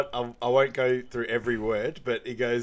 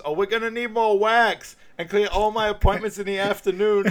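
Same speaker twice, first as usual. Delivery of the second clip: loud, high character voice; raised, put-on voice; imitated waxing lady; 9 semitones higher, 7 dB louder than usual. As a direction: call it out like a sassy beautician. Acting out the waxing lady's quip.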